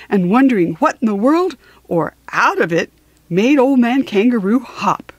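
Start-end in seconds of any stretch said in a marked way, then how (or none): none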